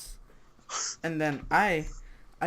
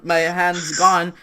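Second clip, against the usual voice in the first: ordinary delivery, nothing marked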